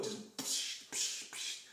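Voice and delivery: breathy psh spurts; voiceless hiss of blood spurting; squirting noise. Retold or imitated.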